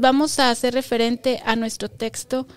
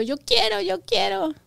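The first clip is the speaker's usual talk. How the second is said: put-on high begging child voice, pitched up; whiny child; 9 semitones higher than usual. Mother imitates a child begging parents, high whiny pleading. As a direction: beg like a little kid. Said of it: "le han dicho".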